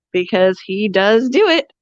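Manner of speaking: usual speaking voice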